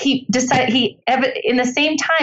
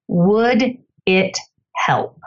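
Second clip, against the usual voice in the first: very slow, deliberate, unruffled reply; calm spy; much slower than usual. Acted. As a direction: space out each word, completely unfazed. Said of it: "he is the calmest man".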